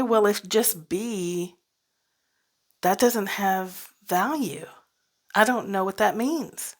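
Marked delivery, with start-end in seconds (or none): none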